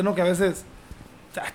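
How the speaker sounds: ordinary talk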